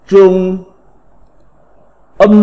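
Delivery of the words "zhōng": "trung" read as Mandarin zhōng; phát âm tiếng Trung. Shown as a demonstration. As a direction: hold "trung" on a level Chinese first tone. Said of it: "Phiên âm tiếng Trung đọc là". ("trung" đọc kiểu tiếng Trung, thanh ngang)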